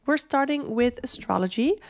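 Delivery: ordinary delivery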